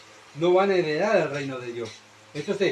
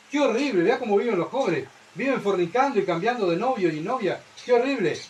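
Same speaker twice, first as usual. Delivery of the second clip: higher, mock-horrified tutting; mock scandalized gossip; 4 semitones higher than usual. Preacher mocking church members who judge youth, scandalized. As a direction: play the shocked churchgoer clutching pearls.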